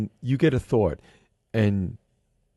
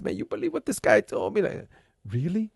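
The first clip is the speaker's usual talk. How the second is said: mock aggrieved gossip; 9 semitones higher than usual. Retold grudge over a slick remark.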